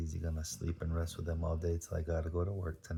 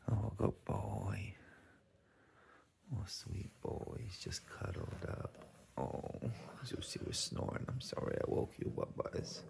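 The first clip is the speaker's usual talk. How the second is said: whisper; 6 dB quieter than usual. Real, not acted. Owner whispering to dozing dogs, soft hushed whisper. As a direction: whisper so the dogs stay asleep.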